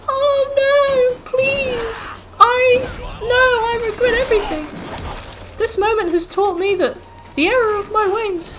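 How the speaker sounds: mock-pleading in a very high put-on girl voice; high-pitched girl impression. Girl pleading with armed thugs, high-pitched mock voice. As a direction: put on a squeaky-high damsel in distress. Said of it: "Why am I doing a high-pitched voice".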